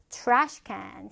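usual speaking voice

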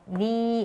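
"니" held long; "니" as a sustained NII; long vowel. Shown as a demonstration. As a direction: stretch out the vowel of "니". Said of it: "이렇게 장음으로 읽는 거잖아".

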